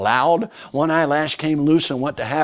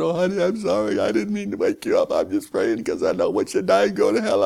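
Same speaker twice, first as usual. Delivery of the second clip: voicing the wife; 3 dB louder than usual. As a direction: apologise sweetly to the husband. Wife answers her husband.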